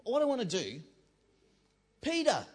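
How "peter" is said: loud call for someone offstage. Real, not acted. Preacher calling Peter to bring the buckets back, calling out. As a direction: call his name across the room.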